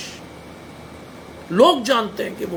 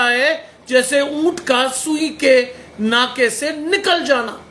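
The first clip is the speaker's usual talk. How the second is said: drawn-out, higher-pitched scripture proclamation; forceful preaching; 4 semitones higher, 4 dB louder, slower than usual. Acted. Preacher quoting the Bible on the rich entering God's kingdom, forceful preaching. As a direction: declaim the verse slowly, voice raised.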